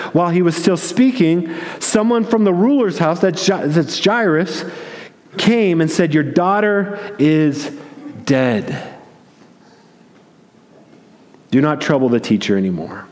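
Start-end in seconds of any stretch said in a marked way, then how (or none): 6.1-13.1 somber bad news. Messenger brings news of death.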